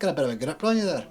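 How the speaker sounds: fast, loud Scottish quip; thick Scottish accent. Acted. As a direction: rattle it off in Scottish. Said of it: "He has a thick Scottish accent".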